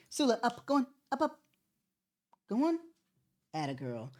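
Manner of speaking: high, soft coaxing voice for the dog. Real, not acted. Coaxing Sula onto her spot, high and soft. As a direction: sweet-talk the dog up onto the bed.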